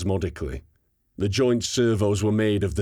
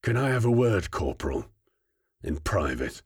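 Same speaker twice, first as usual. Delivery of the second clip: low growl. A narrator's reading. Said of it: "hate-filled growl".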